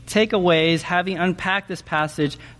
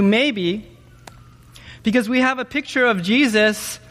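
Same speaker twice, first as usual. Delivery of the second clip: ordinary delivery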